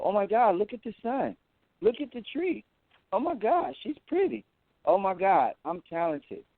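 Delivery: put-on high, amazed, childlike voice; gushing over everything like seeing it new; newly sober wonder. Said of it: "They have to go".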